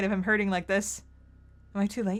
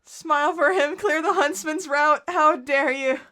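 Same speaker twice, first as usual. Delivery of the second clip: angry outburst; 8 semitones higher, 7 dB louder than usual. Real, not acted. Reacting angrily to the game.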